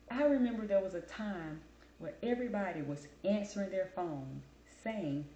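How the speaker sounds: ordinary talk, nothing marked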